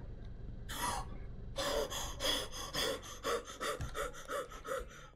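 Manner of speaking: startled breathless gasp; sharp gasp. Real, not acted. Player gasping after a narrow escape, sharp gasp. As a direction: gasp in fright as the monster nears. Escaping the monster in horror game.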